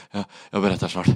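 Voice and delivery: broken words between pants; acted breathlessness. Rider on the floor puts off explaining, panting. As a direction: gasp out the answer, out of breath.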